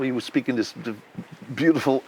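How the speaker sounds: normal talking voice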